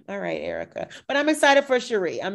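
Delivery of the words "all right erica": much lower, softer resigned reply; unimpressed. Retold or imitated. Host reacting to Erica's act, unimpressed. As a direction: let it drop low after a sigh, unconvinced.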